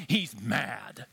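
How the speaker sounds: ordinary delivery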